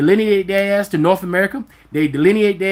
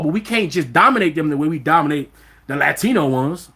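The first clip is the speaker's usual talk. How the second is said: ordinary delivery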